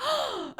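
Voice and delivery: high, sudden mock gasp; delighted kid's intake of breath; imitated excited gasp. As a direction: gasp like a thrilled child. Child spots best friend.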